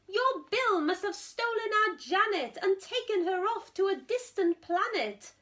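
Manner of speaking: a touch of blaming the other family; understated indignant accusation; accusing. Acted. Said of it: "The Smed said".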